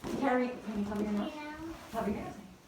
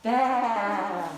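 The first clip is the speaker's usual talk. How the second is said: bleating baa, louder; sheep baa; 2 semitones lower, 6 dB louder than usual. Acted. Teacher showing the children a sheep, bleating loudly. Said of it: "the sheep says".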